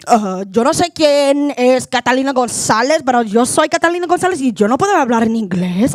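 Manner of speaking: rapid Spanish, pretending to know no English; fake Spanish-only voice. Dodging the telemarketer by pretending to speak only Spanish, fast. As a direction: rattle it off fast in Spanish to dodge the call. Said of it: "And I'll be like".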